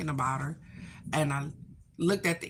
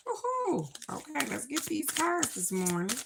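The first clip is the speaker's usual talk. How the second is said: much higher whoop, then bright excited chatter; 9 semitones higher, 3 dB quieter than usual. Real, not acted. Vlogger greeting her viewers before the reading, excited whoop. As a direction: cheer and launch into the morning cards.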